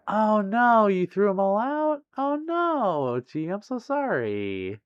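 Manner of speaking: high, drawn-out wail of sympathy; sympathetic dismay. Real, not acted. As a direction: lament it for them, stretching the words. Viewer threw out sausages, she commiserates.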